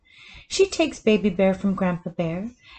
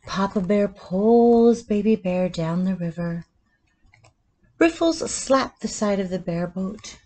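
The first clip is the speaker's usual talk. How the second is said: usual speaking voice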